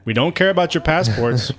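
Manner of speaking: loud, slow, mocking bossy guard voice; mock official shouting. Joking about the game soldiers, a mock guard yelling. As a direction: bark it like a pompous border guard. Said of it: "It's like".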